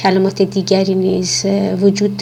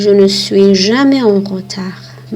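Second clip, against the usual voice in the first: slow model sentence; 3 dB louder, much slower than usual. Shown as a demonstration. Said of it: "مثالهایی رو هم من برای شما ذکر کردم".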